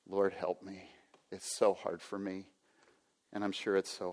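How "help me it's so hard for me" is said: usual speaking voice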